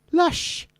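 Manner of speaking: put-on high-pitched Welsh girl voice; Welsh girl accent. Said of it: "as a Welsh girl would say".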